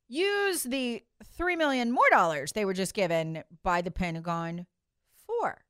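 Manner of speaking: lightly put-on voice of feigned curiosity; mock inquiry. Mock-formal sarcastic question.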